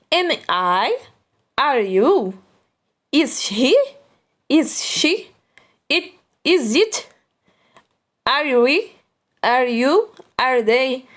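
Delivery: English questions read slowly, each with a clear question tune; question intonation. Shown as a demonstration. Teacher models English questions for students with question intonation. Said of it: "sempre tem essa entonação de pergunta".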